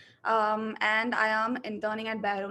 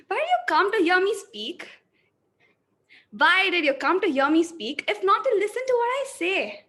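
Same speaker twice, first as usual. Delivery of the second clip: louder, higher, emphatic delivery; energetic, forceful reading; 5 semitones higher, 5 dB louder than usual. Shown as a demonstration. Acting student reads the second version, louder and higher. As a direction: push every line out with energy. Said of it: "So I'll go with the second one".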